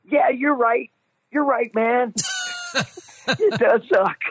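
high, squeaky eager-to-please voice; mock your own nervous, starstruck agreeing; nervous agreement. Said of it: "I was so nervous".

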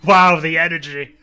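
loud sarcastic wonder; hammed-up, sarcastic enthusiasm; mock amazement. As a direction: exclaim it as if hugely impressed. Mocking the supposedly energetic scene.

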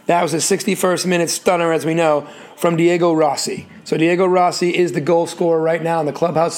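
normal talking voice

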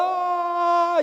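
high, startled squeal; daughter's squeal. Retold or imitated. Little daughter fleeing dad, a high squeal. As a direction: squeal as she runs away. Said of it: "ela cai, tropeça".

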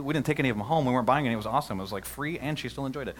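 ordinary delivery

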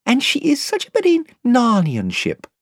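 Lucy wistful. A narrator's reading. Lucy adores the ship.

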